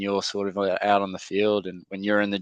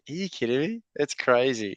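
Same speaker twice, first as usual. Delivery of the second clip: amazed disbelief; 5 semitones higher than usual. Retold or imitated. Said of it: "And I was like".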